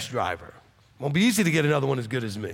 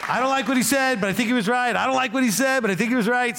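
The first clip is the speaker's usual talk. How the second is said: higher voice, mocking someone who is torn; mimicking a grudging listener; 6 semitones higher than usual. Pastor mimics people going back and forth, in a higher mocking voice. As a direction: play the reluctant person who admits he is right. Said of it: "People do that all the time".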